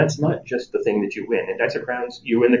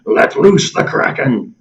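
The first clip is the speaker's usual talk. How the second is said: slow, louder pirate growl of a call; 4 semitones higher, 6 dB louder, slower than usual. Acted. Calling out the game's rule, slow pirate voice. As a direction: boom it out like a pirate captain. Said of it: "commitment to actually doing a pirate voice".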